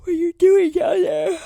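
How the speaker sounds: loud, high, slow demand; accusing shout. Acted. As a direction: yell it at the suspect. Pressing the witness.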